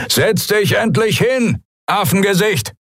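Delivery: high, wired, aggressive thug voice; high, jittery bullying pirate; drugged-up pirate. A narrator's reading. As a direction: snap at her like a pirate high on drugs. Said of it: "seine Stimme von Silberfeuer … aufgeputscht".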